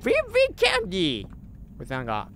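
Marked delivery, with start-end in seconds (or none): 0.0-1.2 high playful mimic of a cute game voice; a light copy of the candy cat's voice; mimicking the character. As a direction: echo the creature's line in its voice.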